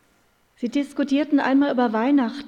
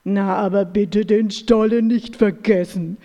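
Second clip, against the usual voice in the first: louder, faster bear grumble; grumbling bear; 2 semitones lower, 7 dB louder, faster than usual. A narrator's reading. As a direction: growl it out like a hungry bear. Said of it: "brummte der Bär".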